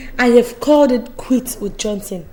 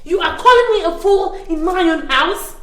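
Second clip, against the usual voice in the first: higher, affronted questioning; indignant disbelief; 6 semitones higher than usual. Acted. Host called a fool by her friend, indignant. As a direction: pitch it up in offended disbelief. Insulted in her own house.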